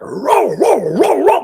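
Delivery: high, loud barked "row row"; imitated loud woof woof; dog bark imitation. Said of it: "I hear this loud dog bark".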